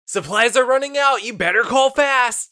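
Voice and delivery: high, loud urgent pitchman; home shopping host. Pretend. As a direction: hype the scarcity. Mock infomercial pitch.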